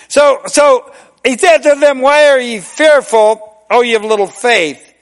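loud, high, slowed sermon question; full-force preaching. Acted. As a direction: lean into Why and let it ring. Preaching repeat of Jesus' question.

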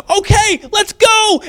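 very high, eager and quick; high excited agreement; eager shepherds. Retold or imitated. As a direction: jump at the idea, ready to run. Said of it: "And so we're like".

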